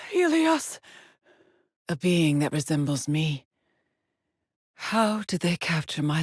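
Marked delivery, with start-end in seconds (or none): 0.1-0.8 high-pitched, alarmed outcry; startled cry. Acted. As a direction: cry out in sudden shock. Crying out a name in shock.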